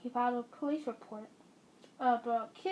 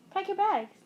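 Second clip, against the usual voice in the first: insistent repeat; 7 semitones higher, 5 dB louder than usual. Acted. Repeated insistently after "What?.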